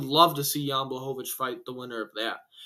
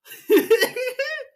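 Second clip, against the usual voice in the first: loud high burst of laughter; 5 dB louder than usual. Real, not acted. Vlogger laughing at his own bold fight prediction, high loud laugh. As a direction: cackle at your own hot take.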